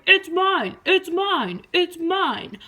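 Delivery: mock chant of frogs shouting. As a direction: imitate the squabbling frogs. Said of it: "I can hear you shouting".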